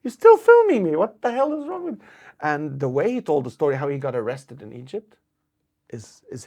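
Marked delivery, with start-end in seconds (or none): 0.0-2.0 higher, louder mock telling-off; scolding the crowd. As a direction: call out the people still filming. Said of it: "he goes".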